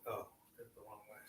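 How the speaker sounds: usual speaking voice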